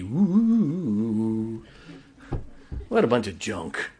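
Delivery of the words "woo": long wavering ghostly "wooo"; mock ghost wail, drawn out; put-on eerie hoot; spooky woo. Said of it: "We wanna sound real spooky".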